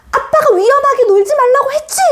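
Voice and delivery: high, sharp scolding yell, imitated; hammed-up angry dad telling off his son; mock scolding father. Father scolding his injured son for playing dangerously, mock angry yell. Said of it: "아빠의 소리가 떨어졌지".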